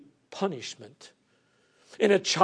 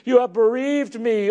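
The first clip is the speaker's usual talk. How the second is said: louder, higher anguished cry on "You have bereaved me"; crying out a lament; 6 semitones higher, 3 dB louder than usual. Acted. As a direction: wail it out as the grieving father Jacob. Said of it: "he cried out a lamentation".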